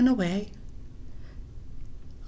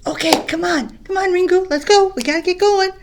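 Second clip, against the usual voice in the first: higher and much louder, hurrying him along; eager calling; 8 semitones higher, 8 dB louder than usual. Acted. Batu calling Ringu home, loud and eager. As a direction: call him to come, impatient and cheerful.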